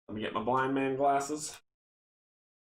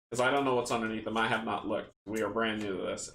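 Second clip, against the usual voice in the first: ordinary talk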